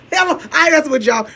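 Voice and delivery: loud, high burst after the bit; laughing shout. Real, not acted. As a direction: shout it out, cracking up. Breaking off the joke, laughing.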